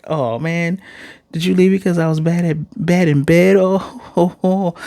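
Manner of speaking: hammed-up sobbing "oh"s at the end; mock crying. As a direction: imitate a tearful wail, half laughing. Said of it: "And then Rudy's crying".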